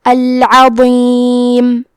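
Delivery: very slow العظیم with a thick, heavy ظ. Shown as a demonstration. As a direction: give the ظ of العظیم a full, heavy sound. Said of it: "یہ حرف کو موٹی آواز میں پڑھیں گے".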